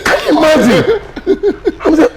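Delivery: high laughing disbelief. Real, not acted. Telling a friend an absurd story, laughing. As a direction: laugh it out, pitch jumping up.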